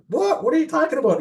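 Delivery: sudden high-pitched "what?" of disbelief; retold disbelief. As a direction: jump way up in pitch in stunned surprise. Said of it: "We're like".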